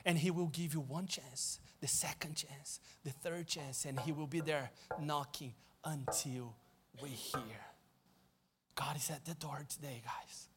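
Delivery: soft, hushed appeal over music; tender altar call. Acted. Preacher invites the congregation to open the door, hushed. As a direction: draw them in gently, fading to a hush.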